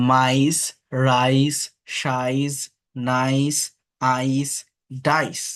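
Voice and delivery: each word alone, the আই in মাইস, রাইস clear; teacher word drill. Shown as a demonstration. Teacher drilling the i-e sound for students. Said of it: "উচ্চারণ আই হয়".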